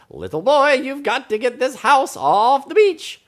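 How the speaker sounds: high, loud ordering voice; scolding story character. Acted. Story character orders boy off beach.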